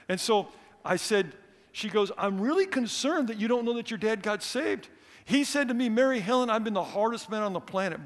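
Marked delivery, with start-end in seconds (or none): 2.2-8.0 higher and louder, urgent; eager, raised retelling; excited retelling. Retold or imitated. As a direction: lift the voice with the amazing news. Social worker quoting his dad.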